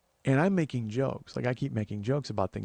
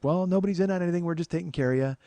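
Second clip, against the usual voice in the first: brisk nurse reassurance; 7 semitones higher, 4 dB louder, faster than usual. Retold or imitated. Nurse calms paranoid patient.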